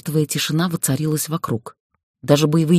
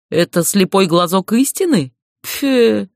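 scornful snort; 5 semitones higher, 3 dB louder, slower than usual. A narrator's reading. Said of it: "пренебрежительно фыркнул полуторарогий".

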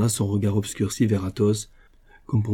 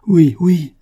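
high, loud, eager yes; moved cry; 8 semitones higher, 10 dB louder than usual. Acted. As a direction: burst out, deeply touched. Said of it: "s'écria Raoul, touché jusqu'au fond du cœur".

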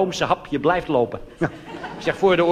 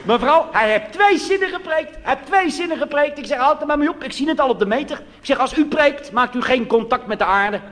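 a hint of a panicking preacher; mock panic; 10 semitones higher than usual. Real, not acted. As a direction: play the flustered minister. Excited retelling to audience.

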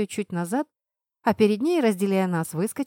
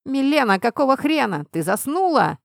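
raised, exasperated voice; loud, irritated rebuke; angry yelling; 5 semitones higher, 4 dB louder than usual. A narrator's reading. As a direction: yell at a slacking teammate. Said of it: "орал волк".

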